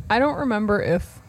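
ordinary talk, nothing marked

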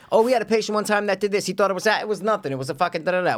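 high, fast gossipy nurse voice; imitating medical staff. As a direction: rattle it off like a chatty nurse. Mimicking medical staff's stories.

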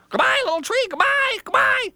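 very high, loud squeaky farewells; a frightened critter calling goodbye as it runs; squeaky hurried goodbyes. Acted. Animals fleeing call goodbye to Little Tree, high squeak.

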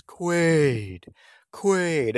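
drawn-out creature croon, falling in pitch; Kuato. Acted. Mutant calling the hero by name, eerie creature voice. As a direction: call the name slowly in a strange mutant voice. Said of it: "it talks like this and goes".

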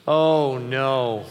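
loud, sorry oh no; sympathetic oh no. Shown as a demonstration. Dad to kid missing the party, sympathetic. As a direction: say it like you truly feel for them. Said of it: "it goes like this".

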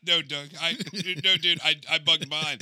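high, loud words through laughter; real laughing. Real, not acted. Speaker answering co-host's joke about bugging his car, laughing hard. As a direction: crack up while answering back.

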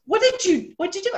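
high-pitched, incredulous questioning; mock alarmed question. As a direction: imitate a startled colleague demanding details. Acted alarmed colleague's question.

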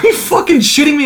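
high, loud retold outburst; exasperated disbelief. Telling co-host about the dead pen, exasperated. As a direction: act out disbelief with a laugh in it. Retelling his exasperated reaction.

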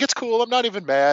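high, sarcastic not-mad voice; sarcastic mock of her being fine; mock fake calm. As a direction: pretend to be fine through clear annoyance. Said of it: "She's like".

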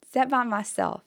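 ordinary talk

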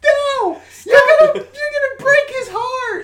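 shrieking mock-mother, very high and loud; hammed-up hysterical falsetto protest; frantic mom impression. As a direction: wail it like a distraught mom. Acted mother protesting for ten minutes.

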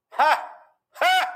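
high, loud single "ha" bursts; smug mock laugh. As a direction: imitate a scornful scoffer's bark of laughter. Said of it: "Just smug".

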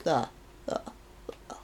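hushed whispering vampire; Dracula voice. Retold or imitated. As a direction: breathe it like Dracula. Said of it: "It's a very quotable voice".

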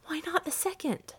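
high-pitched, intense question; feverish. A narrator's reading. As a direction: press it feverishly without shouting. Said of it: "said the old woman feverishly".

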